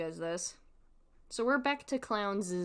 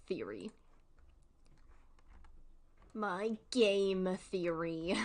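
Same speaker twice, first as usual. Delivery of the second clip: slow jokey tagline; slower than usual. Pretend. Jokey riff on Game Theory.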